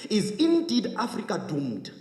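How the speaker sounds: high-pitched, urgent public speech; rousing speech with insistent rhetorical questions; impassioned oratory. Real, not acted. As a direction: press each rhetorical question on the audience.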